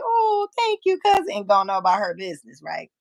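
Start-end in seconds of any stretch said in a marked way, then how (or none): 0.0-1.3 much higher, sweet mock thank-you; high mock cousin voice. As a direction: chirp it like the cousin brushing you off. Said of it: "make her say".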